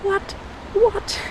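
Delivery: high-pitched disbelieving double what; replaying her stunned reaction, pitch shooting up; shocked what. Retelling the woman's accusation, high stunned disbelief. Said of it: "I'm like".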